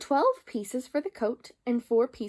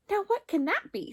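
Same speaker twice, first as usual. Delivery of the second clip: high startled question; startled tailor; 9 semitones higher than usual. A narrator's reading. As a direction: jump at the sound. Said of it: "said the tailor of Gloucester".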